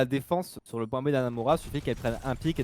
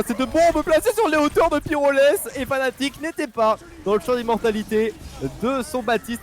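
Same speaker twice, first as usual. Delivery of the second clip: fast, high live esports call; play-by-play commentary; 6 semitones higher, 3 dB louder than usual. Acted. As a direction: call the fight as it happens. Caster calling live team fight.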